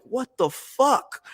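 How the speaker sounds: high, slow stunned "what the fuck"; retold shock. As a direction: gape in disbelief, dragged out. Retold shock at wrestling manager.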